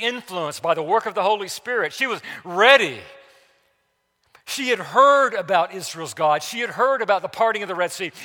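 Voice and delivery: loud, driving sermon voice; fiery preaching. Acted. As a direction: preach it hard over the congregation. Preaching at full force.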